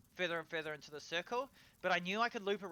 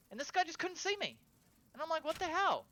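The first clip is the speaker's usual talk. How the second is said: much higher, incredulous; baffled; 12 semitones higher, 4 dB louder than usual. Real, not acted. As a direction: ask it in high disbelief. Baffled at oblivious enemy.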